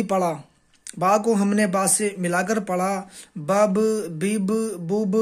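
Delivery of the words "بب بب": بب، بب stretched slowly, sound by sound; slow spelled-out drill. Shown as a demonstration. Teacher sounding out بب slowly for pupils. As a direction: spell out بب slowly before reading it whole. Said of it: "ان کو جلدی پڑھتے ہیں، کھینچ کر نہیں پڑھتے".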